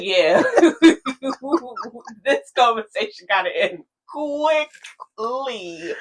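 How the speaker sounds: drawled slowly through laughter; slow, giggly mock aside; laughing inner thought. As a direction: laugh it out slowly as if itching to leave. Mocking her own bored inner thoughts.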